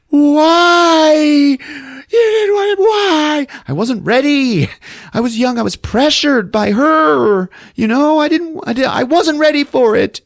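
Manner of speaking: high, loud, wailing argument; hammed-up screamed quarrel, words stretched; anguished yelling. Mother and son fight over her confession, yelling. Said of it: "they're yelling at each other".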